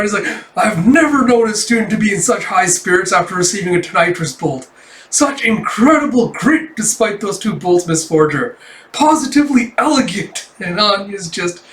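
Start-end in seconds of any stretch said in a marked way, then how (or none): 0.6-10.5 freaking out, higher and emphatic; Henderson. Retold or imitated. Housemaster freaking out at student's high spirits, amazed. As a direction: gush in amazed, over-the-top disbelief. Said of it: "Henderson just freaking out".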